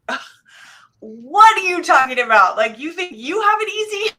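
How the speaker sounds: loud, incredulous snap-back with a scoffing ugh; mock-offended comeback, raising the voice; indignant retort. Wife firing back at her husband's complaint, indignant and loud.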